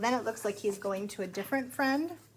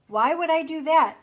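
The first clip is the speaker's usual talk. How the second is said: higher, louder mock indignation; indignant frog; 6 semitones higher, 9 dB louder than usual. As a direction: recoil at the silly idea. Frog rejects Pig's idea.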